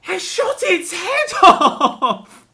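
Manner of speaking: high, loud, drawn-out yell; incredulous shout breaking into laughter; outraged shouting. Real, not acted. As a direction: yell it slowly at full volume, half laughing. Gamer outraged at missed bird shot.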